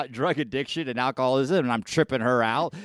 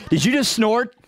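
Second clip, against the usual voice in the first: laughing, higher-pitched disbelief; amused teasing; 6 semitones higher than usual. Real, not acted. Host teasing guest about her snort, laughing. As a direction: tease through laughter, pitch lifted.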